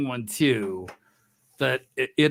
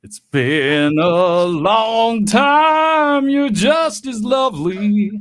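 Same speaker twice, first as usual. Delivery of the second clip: high, slow, swooning diva; campy drag queen; 4 semitones higher, 6 dB louder, slower than usual. Pretend. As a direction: gush it like an old-film star. Campy reunion greeting.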